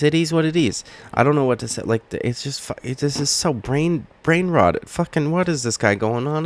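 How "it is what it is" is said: higher, fed-up repeat; exasperated. Real, not acted. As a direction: throw up your hands in disbelief.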